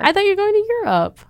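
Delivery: high, fast surprised question. Real, not acted. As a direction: blurt it out in surprise.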